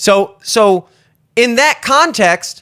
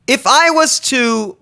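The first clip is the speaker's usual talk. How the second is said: ordinary talk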